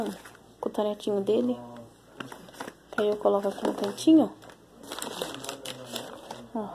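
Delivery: ordinary talk, nothing marked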